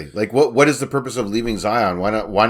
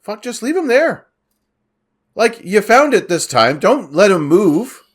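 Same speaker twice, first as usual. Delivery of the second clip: high, incredulous rant; exasperated disbelief at the plot; 9 semitones higher, 5 dB louder than usual. Real, not acted.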